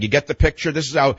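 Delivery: ordinary talk, nothing marked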